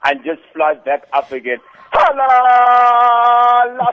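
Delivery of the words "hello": big bright shouted hello; loud greeting. Real, not acted. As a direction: call it out loud and high.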